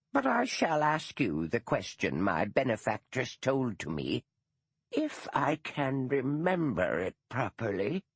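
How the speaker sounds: put-on a stiff elderly schoolmistress, proud and put out; dour old retired teacher. Gaddis recalls the question.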